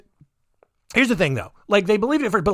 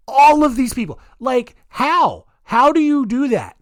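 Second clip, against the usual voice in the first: higher, louder incredulous questioning; exasperated amazement; 7 semitones higher, 5 dB louder than usual. Real, not acted. Host baffled by celebrity buyers, incredulous. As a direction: demand an explanation in baffled disbelief.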